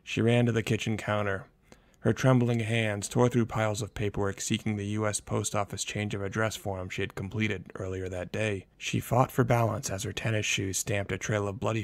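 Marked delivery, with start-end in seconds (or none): none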